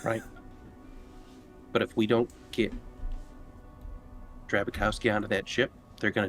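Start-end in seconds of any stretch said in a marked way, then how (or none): none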